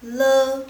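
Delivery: le said very loud and clear; quiz syllable. Shown as a demonstration. Teacher reading a quiz syllable for students, loud. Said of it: "Langsung baca sekali lagi".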